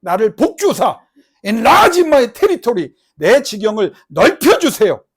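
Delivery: loud, high, slow pleading; crying out in prayer. Acted. Jabez crying out to God, loud and pleading. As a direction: pour a lifetime of grief into the plea. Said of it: "한해 맺힌 기도를 맨날 했다는 거예요".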